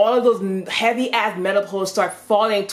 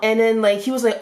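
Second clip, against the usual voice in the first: usual speaking voice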